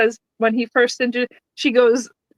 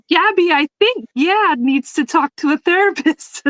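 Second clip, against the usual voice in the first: mock chime-in; 7 semitones higher than usual. Bobby joining in the therapist jab, high put-on voice. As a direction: pile on in a high, slowed character voice. Bobby chiming in, retold scene.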